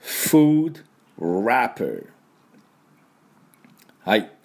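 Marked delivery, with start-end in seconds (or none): 0.0-2.1 very slow "food wrapper" with English F and R; drawn-out teacher model of "food wrapper"; model repeat. Shown as a demonstration. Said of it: "私の後について発音いきましょう".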